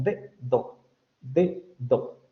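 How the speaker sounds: de and do each said as a hard separate stop; spelled-out syllables. Shown as a demonstration. Phonetics teacher showing how an English speaker would read it. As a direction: split dedo into de, do with a full stop on each d. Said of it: "algo así como".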